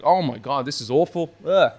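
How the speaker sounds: loud, disgusted groan at the taste. Retold or imitated. First sip of Punk IPA, loud and disgusted. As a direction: recoil from a bad beer, loudly. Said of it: "I was like".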